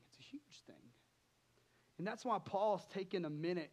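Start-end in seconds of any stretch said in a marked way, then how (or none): 0.1-0.9 sudden whisper for emphasis. Real, not acted. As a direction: drop to a breathy whisper to make the point land. Preacher stresses how big it is.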